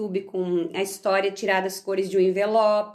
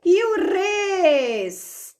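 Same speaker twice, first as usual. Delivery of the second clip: E o res, bem devagar e mais agudo; sílaba arrastada; 8 semitones higher, 4 dB louder, much slower than usual. Shown as a demonstration. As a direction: alongue o res como se ensinasse. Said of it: "Também preciso mais duas letrinhas". (professora alongando res, agudo e lento)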